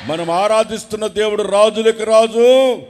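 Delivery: loud, rising sermon shout; full-force preaching. Acted. Preacher shouting to the congregation, fiery and loud.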